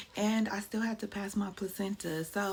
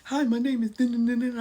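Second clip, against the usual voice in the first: louder, put-on doctor voice with dramatic dun dun dun; mock doctor intro; 5 semitones higher, 9 dB louder than usual. Mom mimics doctor introducing himself, hammed-up dramatic voice. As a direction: ham up the doctor's grand entrance. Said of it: "The doctor's like".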